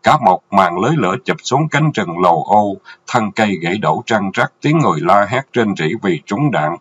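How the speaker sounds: ordinary delivery, nothing marked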